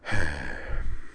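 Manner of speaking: long-suffering exhale; patient sigh. A narrator's reading. Captain putting up with his officers, patient sigh. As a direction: breathe out a patient, falling sigh. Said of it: "Griffin sighed patiently".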